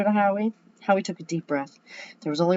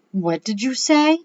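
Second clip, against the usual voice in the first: high, loud, drawn-out disbelief; stunned question; 7 semitones higher, 7 dB louder, slower than usual. A narrator's reading. Howie stops and stares at his best friend, stunned. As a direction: stretch it out, rising in disbelief.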